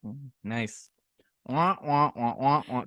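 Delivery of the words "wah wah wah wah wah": muted trombone "wah-wah" noises; trombone imitation. Shown as a demonstration. Host introducing the next question, trombone imitation. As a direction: mouth a wah-wah trombone line. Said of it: "that's trombone for my next question".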